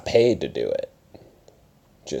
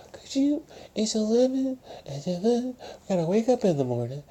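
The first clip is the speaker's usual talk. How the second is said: much higher, softer nagging mom voice; mock his mother telling him to hush; imitating mom; 6 dB quieter than usual. Mother scolding her son about the late hour, much higher. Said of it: "my mom's gonna walk upstairs and be like".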